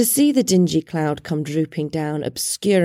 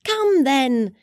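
gaily; 6 semitones higher than usual. A narrator's reading. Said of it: "returned the nephew gaily".

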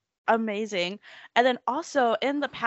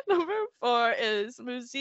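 ordinary talk, nothing marked

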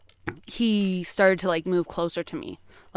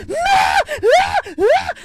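piercing high screams at full volume; retold screaming; 8 dB louder, much slower than usual. Scared girl screaming bloody murder in her room. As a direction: shriek it out as if waking in terror. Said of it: "I'm like".

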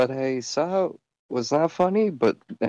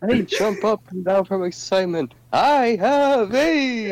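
very high, stretched-out mock squeal; hammed-up excitement; 9 semitones higher, 3 dB louder than usual. Overdoing the joke's punchline for friends, hammed-up excitement. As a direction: build to a long, high, louder exclamation. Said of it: "I wanna do it more".

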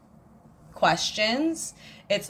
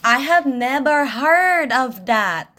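a lightly put-on American Filipino accent, slower and louder; mock American Filipino accent; 5 semitones higher, 7 dB louder, slower than usual. Teasing her co-host in a mock American Filipino accent. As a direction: play at sounding American Filipino, drawn out. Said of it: "you have to sound like an American Filipino".

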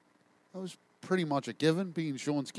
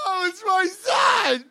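slow, high, loud shout; hammed-up, slow, high yell; mock outraged shout; 13 semitones higher, 13 dB louder, much slower than usual. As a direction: bellow the correction in a put-on voice. Shouted reply, put-on voice.

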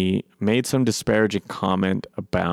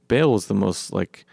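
ordinary delivery

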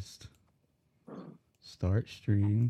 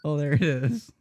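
ordinary delivery

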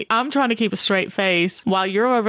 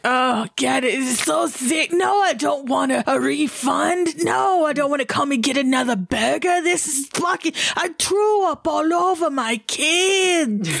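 prank caller voice; 7 semitones higher than usual. Retold or imitated. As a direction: fake disgust to fool the clerk. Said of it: "calling the Burger King".

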